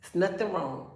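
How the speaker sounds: ordinary talk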